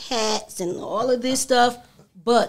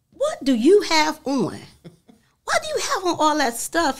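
retold disapproving question; 8 semitones higher than usual.

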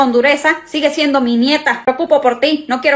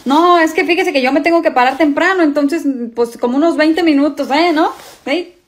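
nervous awkward excuse; 4 semitones higher than usual. Acted. Father trying to cut date short.

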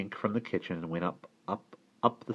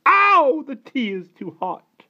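very high, loud yelp of complaint; pained outcry; 12 dB louder, slower than usual. A narrator's reading. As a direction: cry out as if scalded. Aunt burned by hot tea.

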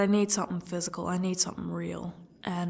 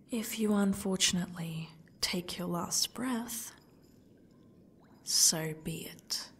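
menacing whisper. Acted. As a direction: breathe the threat, slowing down. Captor threatening captive.

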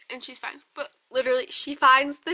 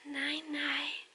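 whisper; 11 dB quieter than usual. Real, not acted. Whispering goodnight to baby.

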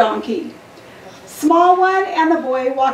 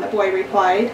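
ordinary delivery

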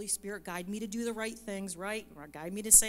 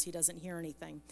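ordinary talk

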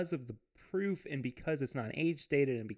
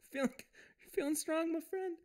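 put-on voice; 3 dB quieter than usual. Putting on a voice for banter.

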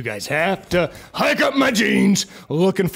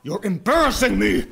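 ordinary talk

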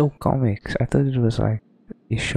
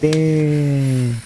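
'Burn' drawn out higher and louder in a mock accent; 5 semitones higher, 4 dB louder than usual. Shown as a demonstration. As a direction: stretch 'Burn' out in a silly put-on accent. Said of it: "You have to say it like that".

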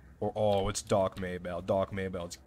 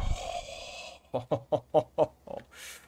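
long breathy run of ho-ho laughter; airy, drawn-out chuckle of delight; gleeful laugh; 5 semitones higher than usual. Real, not acted.

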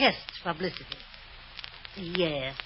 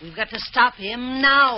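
insistent; 6 semitones higher, 7 dB louder than usual. Acted. Wife pressing husband.

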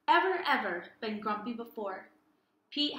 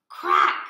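crack sound; 11 dB louder than usual. Acted. Sunglasses break sound effect.

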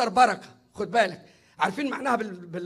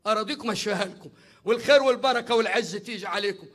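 normal talking voice

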